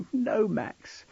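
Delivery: high, small tearful protest; sniffing as she says it. Acted. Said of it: "she sniffed".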